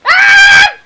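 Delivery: sudden high loud cry; startled yelp. Real, not acted. Daughter startled by lightning, high loud yelp. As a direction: jump at the thunder.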